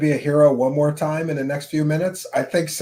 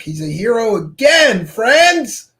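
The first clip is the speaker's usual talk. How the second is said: mock hero announcement; 8 semitones higher, 7 dB louder, slower than usual.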